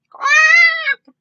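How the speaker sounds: loud, very high quack; a shrieking duck quack; startled duck quack. Acted. Mrs. Mallard quacks tumbling back from cars, very loud and high. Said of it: "went Missus Mallard".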